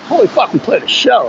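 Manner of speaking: high-pitched burst of retold excitement; excited. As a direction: blurt it out like a thrilled first-timer. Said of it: "being excited that we had played".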